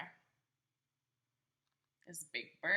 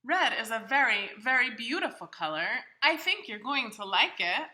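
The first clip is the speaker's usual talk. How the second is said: higher, louder, cheerful Big Bird puzzling over his bag; 5 semitones higher, 13 dB louder than usual. Acted. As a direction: play a big friendly bird talking to the child. Reader voicing Big Bird's lines.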